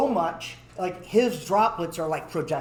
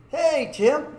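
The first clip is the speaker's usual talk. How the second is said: cheery greeting; 8 semitones higher, 5 dB louder than usual. Retold or imitated. Cheery greeting to sulking friend.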